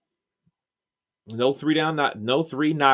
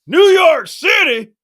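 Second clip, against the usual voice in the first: much higher, louder, slow outraged cowboy; shocked cowboy; 12 dB louder than usual. Retold or imitated. Echoing the salsa ad cowboy, slow shocked yell. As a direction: drag it out in disbelief. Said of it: "that salsa commercial that said".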